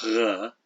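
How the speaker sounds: r as a throaty, voiced gh sound; isolated voiced fricative, written r; voiced velar fricative. Shown as a demonstration. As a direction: rasp the sound written r at the back of the mouth with voice. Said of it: "which sometimes is voiced".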